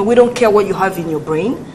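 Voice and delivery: usual speaking voice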